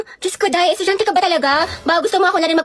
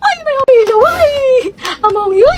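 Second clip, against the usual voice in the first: put-on squealing kid; 8 semitones higher, 6 dB louder than usual.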